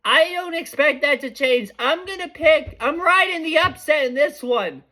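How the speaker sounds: hyped up about the upset pick; excited. Real, not acted. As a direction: pitch climbing with excitement. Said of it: "I'm getting so excited".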